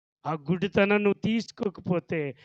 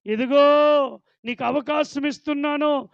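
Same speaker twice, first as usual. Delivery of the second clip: higher, louder preacher voicing the Lord's stern warning; Lord's warning voiced; 7 semitones higher, 5 dB louder than usual. Preacher voicing the Lord warning the church, raised and firm. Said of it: "హెచ్చరిస్తా ఉన్నాడు".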